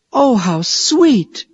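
high, slow, delighted "oh"; pleasantly surprised. A narrator's reading. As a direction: gasp with delight. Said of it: "cried Mary".

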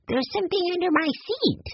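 put-on high-pitched child voice; young boy's discovery. A narrator's reading. Boy discovers a hidden object.

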